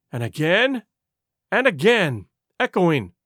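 ordinary delivery

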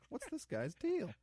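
very high, slow, incredulous; drawn-out disbelief; baffled. Retold or imitated. Friends wondering about Gil, high and incredulous. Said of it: "We were all kinda like".

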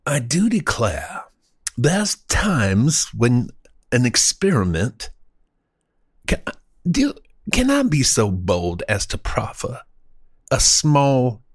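halting, grandiose mock-genteel speaker; florid old-time orator. Host proffering a bold epigram, as a florid orator. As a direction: savor every pompous word with long pauses.

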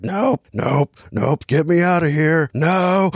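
comic mock terror, pitch climbing to the last no; mock freaking out. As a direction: play a grown man losing it in the dark. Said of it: "starts freaking out".

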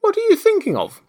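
very high, loud reproach; severe. A narrator's reading. Mouse reproaching inattentive Alice, severe. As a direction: demand it in a shrill mouse squeak. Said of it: "said the mouse to Alice severely".

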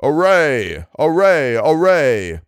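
great voice crying out a battle call; rallying shout. A narrator's reading. Soldier hears a great voice crying, a rallying shout. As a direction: cry out the summons, voice lifting a little. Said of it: "a great voice and a shout louder than a thunder peal".

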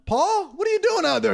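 high-pitched mock townsperson call. Townsperson to Paul riding through at night, calling out. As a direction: call out to him in a high, startled voice.

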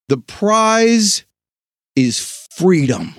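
long pause before freedom, slowed; dramatic pause. Shown as a demonstration. Host to listeners, a dramatic pause before freedom. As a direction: hold the beat, then land freedom. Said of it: "That was a dramatic pause".

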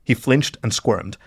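ordinary delivery

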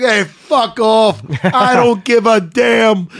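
slow, loud accent imitation; George. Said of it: "it's great with both their accents".

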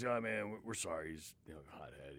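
apologetic buddy. Retold or imitated. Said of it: "his buddy came over and apologized".

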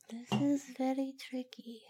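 hushed whisper. Real, not acted. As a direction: whisper it as if thinking hard. Deliberating over score.

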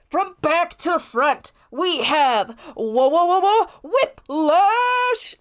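high team introduction, climbing to a shout; arena MC call rising to a big finish; announcer yell. Acted. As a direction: build up to the team name. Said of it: "the M C yells once again".

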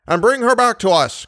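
bright-spirited send-off, pitched well up; cried gaily. A narrator's reading. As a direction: call gaily after someone leaving. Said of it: "cried the commissary gaily".

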